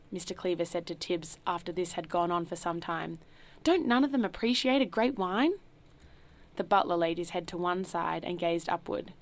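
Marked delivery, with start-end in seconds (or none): 3.6-5.5 indignant. A narrator's reading. Host complains to butler.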